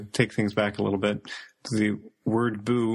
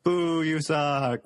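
mock booing; 7 semitones higher, slower than usual.

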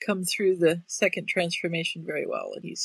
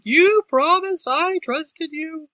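loud, slow, high desperate yell; screaming; 10 semitones higher, 10 dB louder, slower than usual. Retold or imitated. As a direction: scream it out, betrayed. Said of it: "Peter's in there screaming".

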